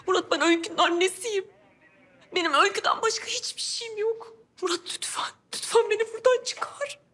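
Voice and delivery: high, crying, breath-broken plea; desperate weeping plea; tearful begging. Acted. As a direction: sob and beg to be released. Begging to be let out.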